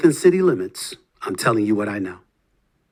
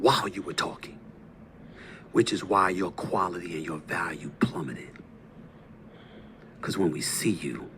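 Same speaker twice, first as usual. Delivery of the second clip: much softer, hushed and cutting; cold whisper; 11 semitones lower, 16 dB quieter than usual. Real, not acted. Host quietly insulting his rival, cold whisper. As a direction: drop to a contemptuous whisper.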